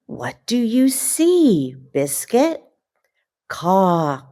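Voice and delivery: lively, slow picture-book reading with puppy noises; reading to children. Acted. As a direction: read it like bedtime story time for little kids.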